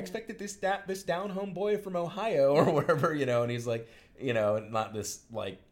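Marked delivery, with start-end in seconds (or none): 0.0-2.5 high, sing-song woman's voice; a woman's voice pitched way up, slowed at the end; high mocking woman. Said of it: "And she's like".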